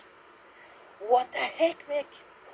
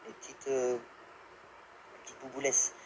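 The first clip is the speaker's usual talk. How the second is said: put-on whispered baby talk; 6 semitones lower, 10 dB quieter, slower than usual. Cooing at little in-game blobs.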